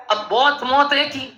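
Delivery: ordinary talk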